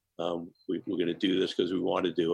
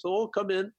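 much higher, light mock holy man; imitating His Holiness; 12 semitones higher than usual. Retelling His Holiness at the door, imitated high. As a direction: sing it up high like a gentle old monk. And he says.

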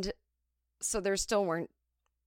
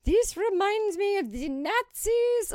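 very high, loud, slow frantic wail; hammed-up French waitress losing her mind; panicked French woman; 8 dB louder, slower than usual. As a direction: go nuts like a frightened French coworker. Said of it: "she was like".

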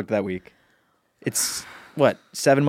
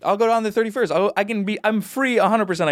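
pitched up, eager to be invited; high pleading; 9 semitones higher than usual. Real, not acted.

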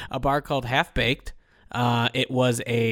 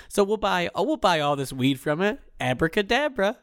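higher, playful movie retelling; lightly acted sting buyer, higher pitched; shoehorned code word; 6 semitones higher than usual. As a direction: slip the code word in with a sudden lift.

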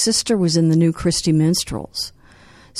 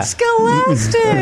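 slow, very high mock British drawl; British accent; much slower than usual. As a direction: stretch it out in a hammy British accent. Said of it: "our different accents for [name]".

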